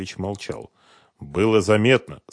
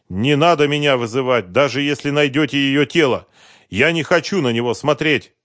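loud, higher-pitched refusal; pleading refusal; 7 semitones higher, 7 dB louder than usual. A narrator's reading. Husband begging not to see his wife's body, pleading. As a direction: insist firmly, voice lifted.

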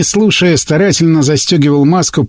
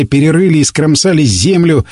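ordinary delivery

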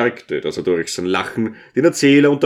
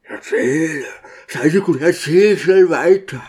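eager whisper; 6 semitones higher than usual. A narrator's reading. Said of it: "flüsterte".

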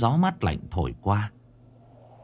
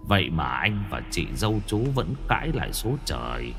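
low, gentle dying elder brother; softly entrust the family; ghost brother's last wishes; 4 semitones lower, 5 dB quieter than usual. A narrator's reading. Dead brother entrusts family to younger brother, low and gentle.

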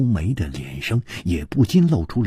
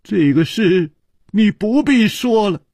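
high, tense and louder; tense, high protest; through gritted teeth; 7 dB louder than usual. Acted. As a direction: cut her off, pained. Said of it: "咬着牙说".

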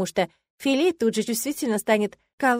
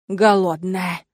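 teasing demon; 5 dB louder, much slower than usual. A narrator's reading.

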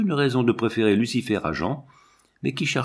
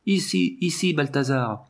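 higher, restrained call; children crying out; 5 semitones higher than usual. A narrator's reading. Two children summoning their dog, crying out. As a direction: beckon the dog in a lifted voice. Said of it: "criaient les deux enfants".

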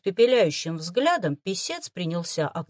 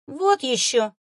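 louder dismissive scoff; scoffing snort; 5 semitones higher, 4 dB louder, slower than usual. Acted. Fox scoffs at the boy's guess. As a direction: brush the idea off. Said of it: "фыркнул Сиян".

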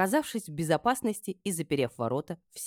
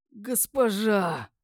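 drawn-out, higher croaked plea; hoarse croak; 7 semitones higher, 3 dB louder, much slower than usual. A narrator's reading. Eldest slave calls to his mistress, croaking hoarsely. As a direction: croak it slowly with lust. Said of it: "вдруг прохрипел самый старший".

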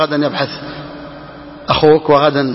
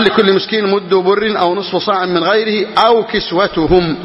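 slow text reading; 3 semitones higher, slower than usual. Acted. Reads the legal text aloud.

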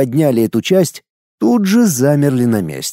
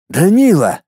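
higher, firmer questioning call; collected, raised-pitch call; slightly louder; 6 semitones higher, 3 dB louder than usual. A narrator's reading. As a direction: call the name with more insistence. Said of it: "чуть громче повторил свой вопрос".

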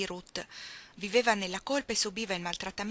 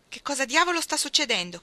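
louder, higher outraged demand; angry demand; 7 semitones higher, 8 dB louder than usual. Acted. Frank confronts the men carrying Ruth's things, loud and angry. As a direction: demand answers, furious.

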